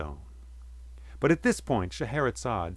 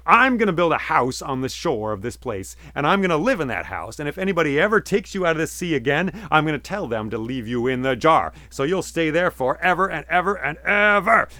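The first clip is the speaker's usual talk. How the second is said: stern threat; 5 semitones higher, 7 dB louder than usual. A narrator's reading. Fisherman threatens the genie.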